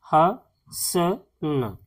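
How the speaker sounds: ह, स, न each said slowly and apart; sounded out syllable by syllable. Shown as a demonstration. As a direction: break हसन into three drawn-out sounds with pauses. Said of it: "लिखना है".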